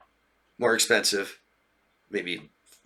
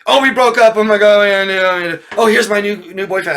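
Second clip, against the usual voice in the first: much higher and louder giddy gushing; mock giddy; 9 semitones higher, 9 dB louder than usual. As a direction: squeal the news like a teenager. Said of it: "they come out".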